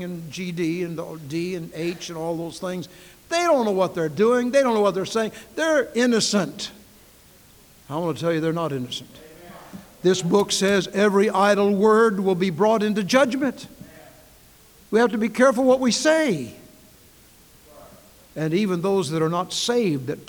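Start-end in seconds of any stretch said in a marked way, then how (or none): 3.3-6.7 higher and louder, a mock plea of innocence; mocking excuse. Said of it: "I heard somebody say". 10.0-16.6 much louder and higher, pounding each phrase; full-force preaching. Acted.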